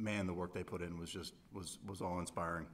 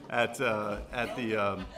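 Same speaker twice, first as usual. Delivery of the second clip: laughing; 2 semitones higher, 9 dB louder, slower than usual. Real, not acted. Laughing at juror's F-bomb.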